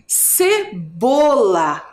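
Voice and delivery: CEBOLA very slowly, syllable by syllable; drawn-out syllables. Shown as a demonstration. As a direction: stretch out CE-BO-LA. Teacher sounds out the word. (CEBOLA bem devagar, por sílabas)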